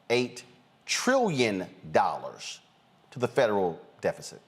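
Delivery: each key word set apart; slow corrected figure, paused between words; deliberately slow. Shown as a demonstration. Host correcting the deficit figure, slow and deliberate. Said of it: "let me say this slowly".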